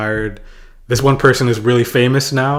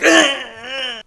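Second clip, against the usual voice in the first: much higher mock yelp; pained squeak. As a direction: squeak as if hit. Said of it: "I'm like".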